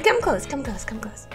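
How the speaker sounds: normal talking voice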